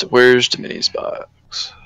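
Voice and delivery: ordinary talk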